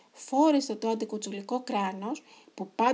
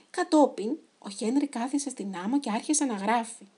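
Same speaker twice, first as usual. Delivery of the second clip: ordinary delivery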